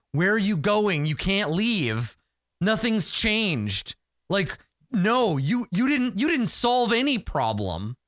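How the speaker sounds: a desperate villager begging you to stay; pleading young warrior. Acted.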